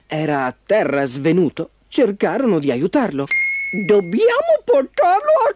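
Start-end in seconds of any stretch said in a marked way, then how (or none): 3.9-5.5 very high, determined little-car voice; decisive little car. A narrator's reading. Minnie deciding to rescue fainted Ford, firm squeaky voice. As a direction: declare it firmly in a tiny high voice. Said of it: "disse Minnie con decisione".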